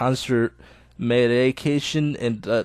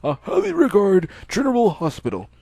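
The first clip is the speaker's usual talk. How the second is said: put-on higher, louder, measured old doctor; 6 semitones higher, 3 dB louder than usual. Old doctor telling Hisao he can go home.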